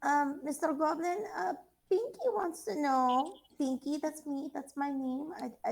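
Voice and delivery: put-on high, eager, childlike sweet voice; cute Pinky. Pinky pestering the goblin for answers, high childlike. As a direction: play it innocent and eager to please.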